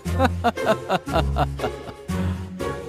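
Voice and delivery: long gloating laugh, falling; villainous laugh. Acted. Trickster leaving with the crown, laughing.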